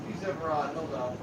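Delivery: ordinary delivery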